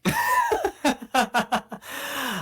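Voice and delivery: long, high peal of laughter; high-pitched, delighted cackle; amused in-character laugh. Acted. Amused character laughs at player's remark.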